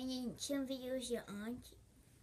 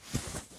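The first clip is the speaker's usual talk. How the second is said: repeated high hee-hee giggle; silly giggle. Acted. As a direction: giggle in little bursts, in character. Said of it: "do this".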